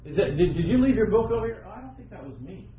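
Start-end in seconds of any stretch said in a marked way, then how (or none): 1.7-2.7 sheepish denial. Acted.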